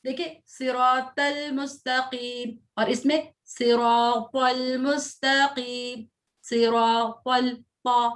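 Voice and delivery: slow सिरातल with a thick, heavy ता. Shown as a demonstration. Teacher stressing the heavy ता for the student, slowly. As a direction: stretch सिरातल and press the heavy ता. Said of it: "ता और ता में difference करना है".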